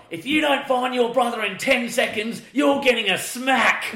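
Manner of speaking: high, scolding mock-mum threat; threatening mum. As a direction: imitate a mum laying down an ultimatum. Mum threatening her small daughter.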